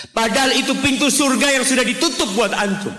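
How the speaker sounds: high, loud fervent sermon voice; impassioned preaching. Acted. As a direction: drive it home to the congregation. Preaching at full force.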